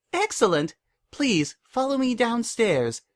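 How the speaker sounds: high, slow eager filly voice; chirpy filly. Acted. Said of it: "the filly chirped".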